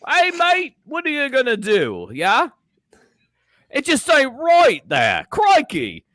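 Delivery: put-on accidental Australian accent. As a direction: aim for British and drift into Australian. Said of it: "Did you just go Australian now?".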